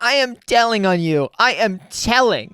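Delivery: lightly voiced whiny tattletale; mock tattletale. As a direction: mimic a kid running to the teacher. Mocking protester calling police.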